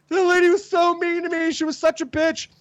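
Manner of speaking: high, whiny imitation; whiny upset friend. Friend complaining about the mean clinic lady, whiny.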